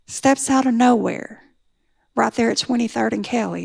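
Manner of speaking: usual speaking voice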